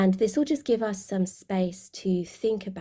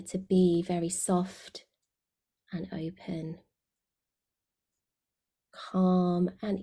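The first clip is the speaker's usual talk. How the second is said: guided meditation. Real, not acted. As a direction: guide them gently inward, letting phrase endings sink away.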